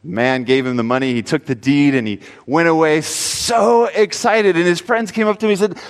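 usual speaking voice

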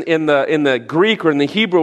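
ordinary talk, nothing marked

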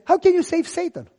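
high incredulous question; indignant protest. Retold or imitated. Young pastor protesting to his wife. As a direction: protest in disbelief.

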